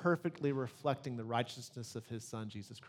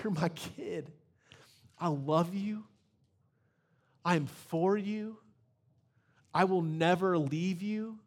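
put-on God speaking tenderly to his child; tender father; 3 semitones higher than usual. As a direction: reassure a lost child gently. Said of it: "he says".

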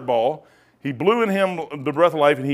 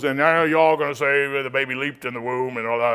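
imitating the professor; 3 semitones lower than usual. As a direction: put on the professor's droning challenge. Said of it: "He goes".

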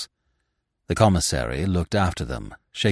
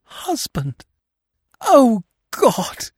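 rising to a high, louder wail of grief; anguished cry; 4 dB louder, much slower than usual. A narrator's reading. Widow collapses at her husband's body, wailing in grief. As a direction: break down crying out to God. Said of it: "she cried".

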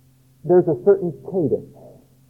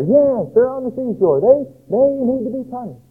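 higher, eager agreement from the listeners; approving crowd voice; 7 semitones higher than usual. Retold or imitated. Israelites in the crowd agreeing the neighbours deserve it, higher and eager. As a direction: nod along, glad someone else gets judged. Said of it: "And then Tyre".

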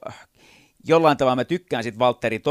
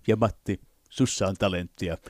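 judge voice; 2 semitones lower than usual. Shown as a demonstration. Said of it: "showing range of voices".

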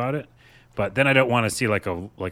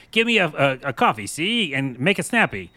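higher, louder 1930s tough-guy talk; old-timey gangster voice; 5 semitones higher, 3 dB louder than usual. Retold or imitated. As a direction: bark it like a movie gangster. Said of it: "walking around going like".

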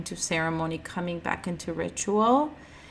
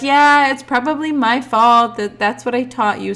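higher, louder grandma admitting fault; imitating grandma; 5 semitones higher, 8 dB louder than usual. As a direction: play grandma owning up.

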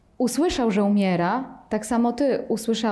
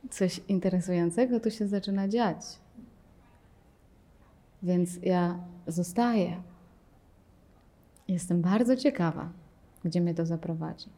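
calm curiosity; 4 semitones lower, 5 dB quieter than usual. Pretend. Teacher shows the calm explorer, soft and curious. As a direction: stay put with quiet interest.

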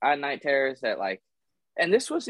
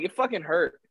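ordinary talk

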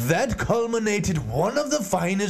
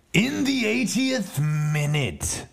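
ordinary talk

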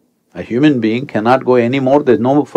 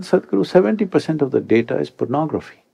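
casual remark; 5 semitones higher, 4 dB quieter, faster than usual. Retold or imitated. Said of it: "very casually he said".

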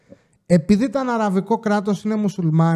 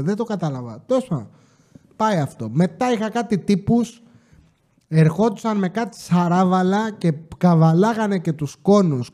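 normal talking voice

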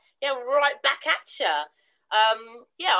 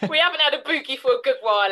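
laughing; 3 semitones higher, 6 dB louder, much faster than usual. Real, not acted. Teasing about dancing together.

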